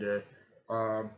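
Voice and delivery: ordinary talk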